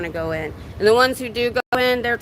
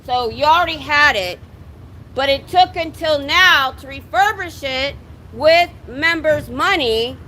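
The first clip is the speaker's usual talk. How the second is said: drill sergeant voice. Real, not acted. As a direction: shout it out to the building. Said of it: "I'm using my drill sergeant voice".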